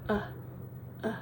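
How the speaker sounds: relaxed short "Uh" twice; schwa drill. Shown as a demonstration. Teacher repeating the schwa for students. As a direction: loose neutral "Uh, uh". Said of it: "really important to master this sound".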